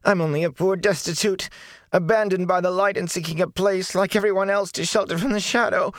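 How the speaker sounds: high, pleading whine of a filthy beggar; whining. A narrator's reading. Filthy prisoner whining to the lord, high and pleading. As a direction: whine it like a cornered wretch. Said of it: "the filthy man whined".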